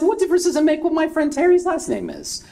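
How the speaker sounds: put-on high-pitched, put-upon drunk protest; indignant drunk. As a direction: bristle at the question like a tipsy barfly. Drunk caller gets belligerent.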